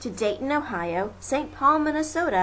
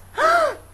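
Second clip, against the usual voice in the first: sharp high gasp of surprise; 7 dB louder than usual. A narrator's reading. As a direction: gasp as if caught off guard. Maisie startled seeing Horton.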